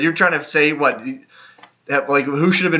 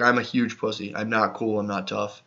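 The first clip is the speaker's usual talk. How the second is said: lower, softer, sinking away; mock sheepish confession; 4 semitones lower, 7 dB quieter than usual. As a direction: admit it meekly, dropping almost under your breath. Voicing rival's imagined humiliated confession.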